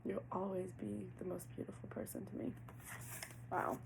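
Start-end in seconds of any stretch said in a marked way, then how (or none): 0.1-2.5 breathy tender whisper. Acted. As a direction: whisper it like a secret confession. Channeling partner's tender words.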